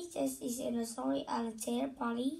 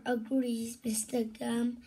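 ordinary delivery, nothing marked

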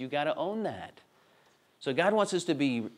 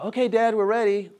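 put-on higher, louder child calling Dad; kids calling out; 7 semitones higher, 7 dB louder than usual. Kids calling to Dad from hiding, raised kid voice. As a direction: imitate a kid shouting from hiding. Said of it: "when they're ready, they go".

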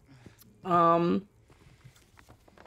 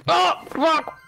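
surprised yelp; 8 semitones higher, 4 dB louder than usual. Real, not acted. Chips startle.

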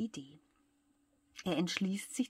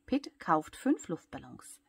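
ordinary delivery, nothing marked

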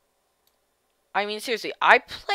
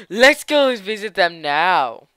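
villain gloat; 2 semitones higher, 9 dB louder, slower than usual. Acted.

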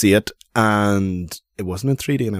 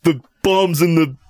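higher, slow mock Ving Rhames; 7 semitones higher, slower than usual. Riffing on Ving Rhames warning Ethan, higher, slow mock voice.

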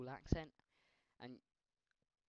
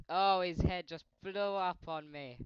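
loud, slow, higher shocked cry; shocked shout; 6 semitones higher, 15 dB louder, slower than usual. Real, not acted. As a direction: wail it in disbelief. Head explodes in game.

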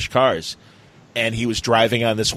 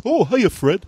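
higher, goofy cartoon sidekick; Barney Rubble voice; 9 semitones higher than usual. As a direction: chipper Barney greeting. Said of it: "first season Barney voice".

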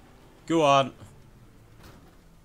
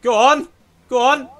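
high, loud go on; excited urging; 10 semitones higher, 5 dB louder than usual. Real, not acted. Urging his friend's car into the crash, loud excited yell. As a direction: yell it at the screen to push him on.

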